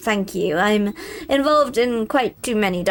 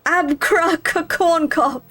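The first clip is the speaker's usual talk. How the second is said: stammering rogue voice; 6 semitones higher, slower than usual. Acted. Rogue fumbling the magic words.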